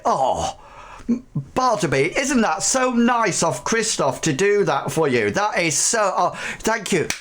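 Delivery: high, gushing guards praising their patron; fawning guards. Acted. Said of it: "the guards turn to you and say".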